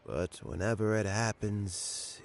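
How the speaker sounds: ordinary talk, nothing marked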